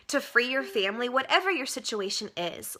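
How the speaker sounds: ordinary talk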